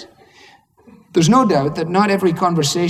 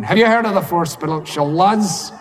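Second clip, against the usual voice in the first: eager Scots evangelist; 3 semitones higher than usual. Retold or imitated. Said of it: "running around Dallas going".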